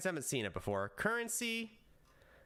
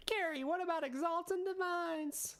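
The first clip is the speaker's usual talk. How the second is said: much higher, squeaky character voice; high put-on voice. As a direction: ask it in a silly high voice. Put-on voice asks question.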